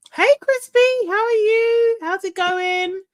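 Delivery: high, bright-pitched hello to a viewer; cheery greeting. Real, not acted.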